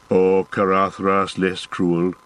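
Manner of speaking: slow, lightly voiced dwarf, bitter; a hint of a grumbling dwarf; gruff dwarf Gimli.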